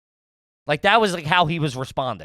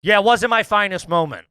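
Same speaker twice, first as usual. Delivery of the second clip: ordinary talk, nothing marked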